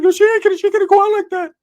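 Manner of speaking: high, loud, whiny cousin voice; Ricky's voice. Retold or imitated. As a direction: squeak it out like a worried goofball. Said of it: "He's like".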